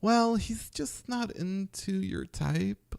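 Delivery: high, slow, soft camp voice. Retold or imitated. Buddy explaining to her in a slow camp voice. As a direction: purr it out flamboyantly.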